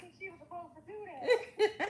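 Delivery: laughing while telling about the cousin; laughing through words. Real, not acted. Telling a friend how her cousin got high, laughing. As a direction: breaks into laughter mid-line.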